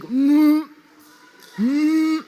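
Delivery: high, strained mock groan of disgust; suffering hum. Daughter imitating dad choking down bugs, mock groan. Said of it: "he's suffering and he's like".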